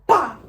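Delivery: loud, sudden "bah" of a body landing; imitated thud. Storyteller imitating her straight fall to the ground, loud thud noise. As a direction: burst it out like a fall.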